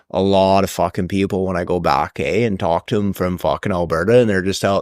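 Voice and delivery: put-on hoser Canadian accent, pitch climbing to the final question. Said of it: "they really have the accent like that".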